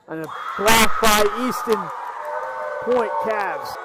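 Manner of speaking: loud, drawn-out block call; excited shout. Real, not acted. Live play-by-play big block.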